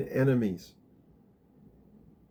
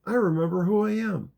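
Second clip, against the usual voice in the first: high, lilting loving affirmation; 8 semitones higher than usual. Shown as a demonstration. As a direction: say it with love, like a friendly hey to yourself. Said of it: "say it with love".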